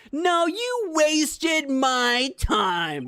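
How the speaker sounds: mock indignant. Scolding the character.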